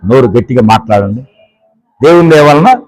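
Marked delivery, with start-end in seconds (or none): 2.0-2.8 said loudly. Shown as a demonstration. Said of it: "గట్టిగా అనండి".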